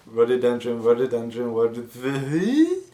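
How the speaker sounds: "rhododendron" repeated in a run till it falls apart; "rhododendron" over and over, last one collapsing upward; tongue twister attempt. Shown as a demonstration. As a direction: repeat "rhododendron", garbling the last into a rising squeal. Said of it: "try saying that ten times fast".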